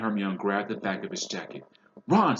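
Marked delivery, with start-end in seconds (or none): 2.1-2.4 sharp call, much higher and louder. A narrator's reading. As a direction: call the son's name to stop him. Father calls out sharply.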